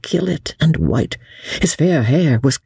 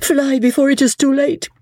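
much higher, urgent pleading cry; desperate near-scream; 12 semitones higher than usual. Acted. Marguerite crying out to warn Percy, desperate and high. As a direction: plead with him to flee, pitched high. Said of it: "she very nearly screamed out to him".

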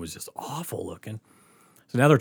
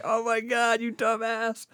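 high-pitched mocking imitation; mock teasing voice, pitched way up; friends' teasing; 11 semitones higher, slower than usual. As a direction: jeer like friends having a laugh. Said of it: "they had a blast with that".

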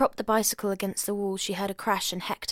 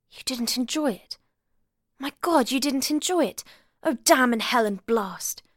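upset outburst; 5 semitones higher, 5 dB louder than usual. A narrator's reading. Upset at failed lovemaking.

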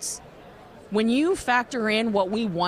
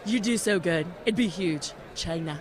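Trump impression; 2 semitones lower than usual. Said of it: "Wow, is that your Trump?".

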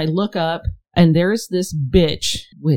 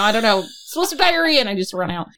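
ordinary talk

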